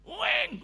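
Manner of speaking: high, loud "weng" blare; siren wail. Retold or imitated. Pastor mimicking the siren that broke into his recording, high siren wail. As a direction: imitate a siren suddenly going off. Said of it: "bunyi sirine ya".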